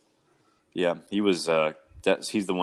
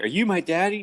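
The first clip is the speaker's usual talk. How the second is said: imitating a little girl, pitched up; mock child's question, high rising pitch; 8 semitones higher than usual. Girl asking a stranger in her room, imitated child voice. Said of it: "in her room and she was like".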